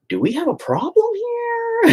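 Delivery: very high, incredulous mock challenge; mock suspicious therapist. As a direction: ham up her alarmed question. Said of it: "and goes".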